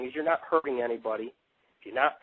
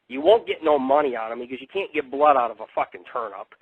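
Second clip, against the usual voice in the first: ordinary talk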